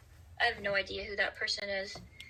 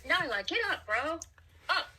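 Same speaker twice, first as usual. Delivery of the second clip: yelling across the room; 6 semitones higher than usual. Real, not acted. Calling the dog off the bed.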